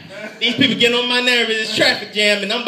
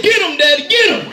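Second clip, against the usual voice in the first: put-on gleeful child urging dad on, louder; excited kid cheer; 5 semitones higher, 5 dB louder than usual. Little boy cheering his dad on against bullies, gleeful. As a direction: cheer like a seven-year-old, pitch jumping on the last get him.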